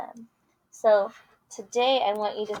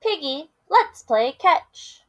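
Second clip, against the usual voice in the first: lively picture-book read-aloud with character voices; reading to children; 5 semitones higher, 5 dB louder, slower than usual. Acted. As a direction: read it big for the kids.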